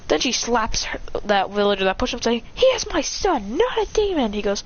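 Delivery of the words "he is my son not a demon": high-pitched protective outburst; acted motherly outrage, pitched up; indignant mother. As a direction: scold it high and fierce. Mother defends son after slap.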